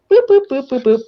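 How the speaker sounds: quick jabbing boops to show the poke; sing-song boops mimicking scissor pokes; poking sound effect. Shown as a demonstration. Demonstrating scissor jabs at dog.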